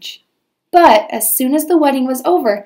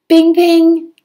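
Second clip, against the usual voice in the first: gunshot bing; 7 semitones higher, 5 dB louder than usual. Acted. Gunshot sound effect.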